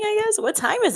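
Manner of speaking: sudden high mock squeak; squeaky aside. Real, not acted. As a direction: jump way up in pitch. Joking reply to viewer.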